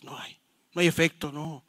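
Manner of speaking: ordinary delivery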